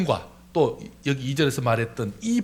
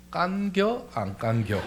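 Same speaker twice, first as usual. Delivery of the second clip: Chungcheong dialect; 5 semitones higher, slower than usual. Shown as a demonstration. Tongue twister shrunk into Chungcheong speech, Chungcheong accent. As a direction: ask it like a Chungcheong local. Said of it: "충청도 말로는요".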